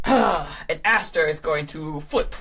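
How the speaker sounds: ordinary talk, nothing marked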